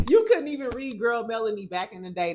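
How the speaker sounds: loud laughing jab at a rival; laughing tease. Real, not acted. Vlogger teasing a reality star while laughing.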